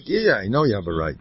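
ordinary talk, nothing marked

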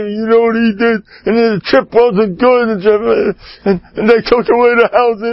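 high, whiny complaint; a hammed-up high kvetch; mock whiner. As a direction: whine it up high like a self-pitying man. Mocking a self-pitying complainer.

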